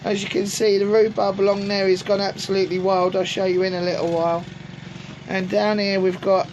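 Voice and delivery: ordinary talk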